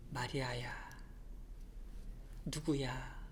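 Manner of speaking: 마리아야 whispered softly, almost no voice; whispered call. Shown as a demonstration. Jesus calling Mary by name, whispered. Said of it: "이렇게 불러주시는 거예요".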